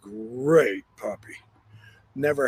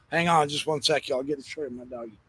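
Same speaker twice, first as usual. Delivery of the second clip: usual speaking voice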